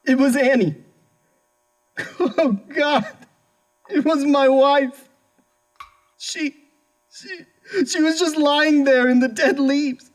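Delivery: high, breaking voice, halting with grief; anguished breakdown. Acted. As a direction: fall apart as you relive finding the body. Husband recalls finding murdered wife.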